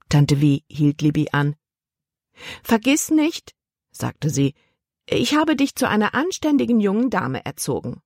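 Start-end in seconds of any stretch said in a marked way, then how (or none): 2.6-3.5 a touch of a fussy aunt giving orders; higher, slow bossy aunt. Acted. Aunt telling hiccuping Libby to breathe, higher and slow. 5.1-8.0 a higher, prim aunt voice, lightly done; a hint of a disapproving old aunt; lightly voiced stern aunt. Aunt lecturing her niece about marriage, lightly voiced, higher and prim.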